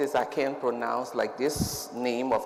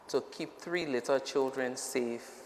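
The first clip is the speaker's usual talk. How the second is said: ordinary talk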